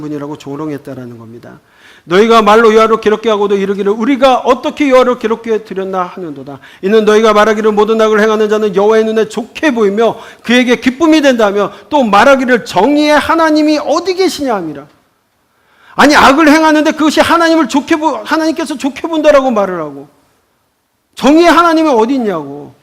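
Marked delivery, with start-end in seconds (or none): 2.0-14.5 loud, raised scripture reading. Acted. Reads scripture aloud in sermon. 16.0-22.7 loud, high, indignant retort. Retold or imitated. Voices the people's complaint indignantly.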